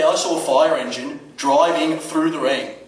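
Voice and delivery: normal talking voice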